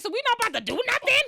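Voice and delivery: high, loud and fast disbelief; mock outraged disbelief; incredulous. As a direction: blurt it out in disbelief. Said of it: "I'm like".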